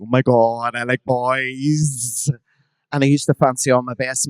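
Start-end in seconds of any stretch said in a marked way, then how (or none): 0.0-2.3 dreamy, drawn-out mock discovery; gasped realization. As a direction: savour the slow self-revelation. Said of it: "I was like".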